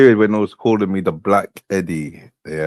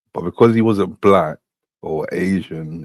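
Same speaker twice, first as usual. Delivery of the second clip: normal talking voice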